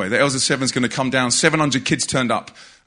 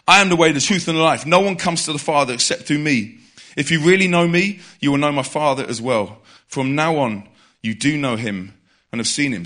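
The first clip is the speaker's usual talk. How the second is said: scripture reading. Acted.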